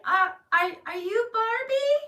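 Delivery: high, slow, hesitant asking; timid question. Retold or imitated. Asking the woman behind the curtain, high timid question. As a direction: ask slowly in a high, unsure voice.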